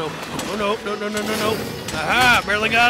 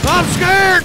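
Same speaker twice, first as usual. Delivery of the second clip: high, loud fake fright; mock scared; 6 semitones higher, 4 dB louder than usual. Pappy floating on the monster's head, mock scared. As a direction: play at being frightened. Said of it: "I'm not really scared".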